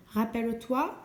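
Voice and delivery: ordinary delivery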